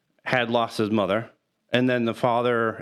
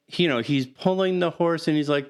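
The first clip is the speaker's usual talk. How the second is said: ordinary talk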